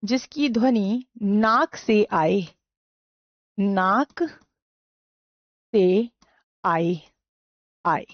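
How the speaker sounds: slow, word-by-word dictation pace. Shown as a demonstration. Teacher dictating a definition to students, slow and deliberate. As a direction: spell it out so students can write.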